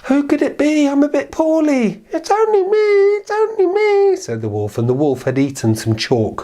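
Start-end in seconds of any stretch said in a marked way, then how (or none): 0.1-2.0 put-on old grandmother. The grandmother answers the knock, high and frail. 2.1-4.2 very high, slow, fluffy fake voice; the wolf faking sweetness; soft disguised wolf. The wolf at the door disguises its voice, very high and soft.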